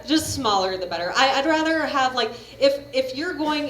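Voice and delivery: ordinary talk, nothing marked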